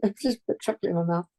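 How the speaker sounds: ordinary talk, nothing marked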